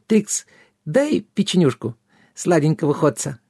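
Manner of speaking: high fairy voice, begging; pleading little fairy. A narrator's reading. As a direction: wheedle in a small high voice. Tipsy fairy begs for a cookie.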